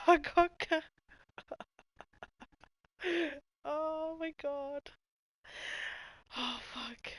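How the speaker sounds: high laughing oh my god, out of control; can't stop giggling at the picture; laughing fit. Real, not acted.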